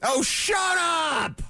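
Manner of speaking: high, slow, drawn-out mock shout; hammed-up shout at whiny fans; shouted shut up. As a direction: blast it like a drop-in sound bite. Mocking outraged fans.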